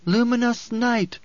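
high, drawn-out oracular cry. A narrator's reading. Oracle booming to a crowd of pilgrims, crying out. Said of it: "she cried".

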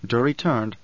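usual speaking voice

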